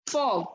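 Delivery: "Fog" as one isolated beat; single-beat word. Shown as a demonstration. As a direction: say "Fog" in a single pulse, standing alone. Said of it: "With a single beat".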